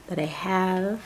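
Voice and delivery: ordinary talk, nothing marked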